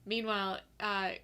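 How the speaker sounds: ordinary delivery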